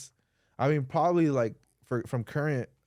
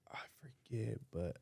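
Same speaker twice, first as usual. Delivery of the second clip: whispered aside; whispering; 4 semitones lower, 17 dB quieter than usual. Real, not acted. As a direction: whisper it to yourself. Aside to himself.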